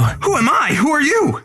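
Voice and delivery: high, loud startled comeback; indignant shout. Acted. Frank confronting the stranger with a broom, indignant shout. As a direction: throw the question back.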